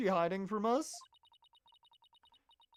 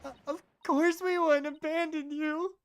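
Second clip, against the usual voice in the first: reassuring; 8 semitones higher, 4 dB louder than usual. Acted. Reassuring a crying character.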